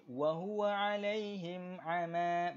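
Quran recitation. Shown as a demonstration. Teacher reciting verse for the class, slow melodic tajwid. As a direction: recite for students to follow.

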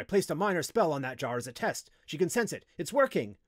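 excited. A narrator's reading. Wizard explaining his test spell works, excitedly. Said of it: "Noraim exclaimed excitedly".

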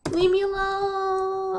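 slow drawn-out plea. Acted.

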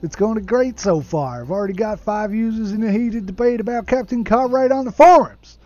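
gushing mock-American, building to a shout; dodgy American accent. Client emailing about his film archive, hammed-up American accent. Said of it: "I don't know why he's suddenly American".

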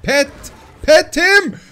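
high, loud repeated commands; full-voiced, high-pitched urging; urgent yelling. Real, not acted. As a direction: shout increasingly frantic orders.

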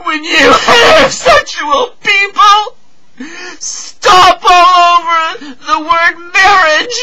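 high, loud, sobbing accusation; anguished, furious high-pitched wailing; crying tirade. Acted. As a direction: shout accusations through tears. Tearful accusation of straight people.

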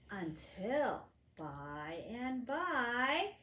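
plain reading voice

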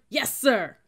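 loud, high military answer; shouted response. A narrator's reading. Recruits answering the yelling cadet, shouting. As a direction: snap to and shout it.

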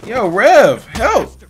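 loud urgent call for help; shouting for help. Real, not acted. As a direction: yell out to a friend for help. Calling out to game character.

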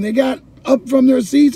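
normal talking voice

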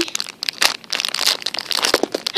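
long gasp of amazement; stunned, thrilled gasp; excited gasp. Real, not acted. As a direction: suck in a huge delighted breath. Sees a long-wanted toy.

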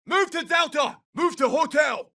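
high-pitched battlefield shout; urgent combat order. Acted. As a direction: call the squad forward across the field. Combat order called to squad.

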